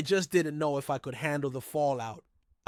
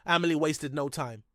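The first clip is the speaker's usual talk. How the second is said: ordinary delivery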